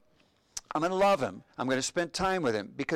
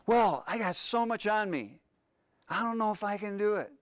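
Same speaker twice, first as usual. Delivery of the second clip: higher, plaintive voice of a burdened man; overwhelmed believer; 7 semitones higher than usual. Retold or imitated. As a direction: sound like someone ready to give up. Voicing a struggling believer.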